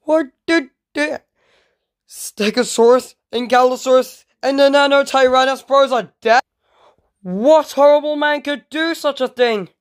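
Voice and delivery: high-pitched, shocked outcry; high, distraught disbelief; horrified dismay. Pretend. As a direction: wail it out in play-acted horror. Learns friends are dead.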